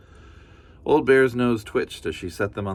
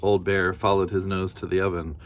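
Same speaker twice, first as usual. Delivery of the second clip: plain reading voice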